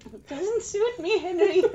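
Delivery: put-on high, slow old dear; a doddery granny protesting to Henry; old lady voice. Old couple role play.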